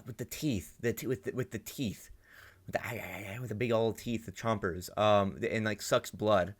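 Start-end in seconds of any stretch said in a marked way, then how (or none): none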